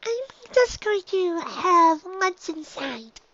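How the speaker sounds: high, slow little filly backing off; a small filly edging away from the crowd; hesitant filly voice. A narrator's reading. Dinky backing away from the staring foals, high and slow.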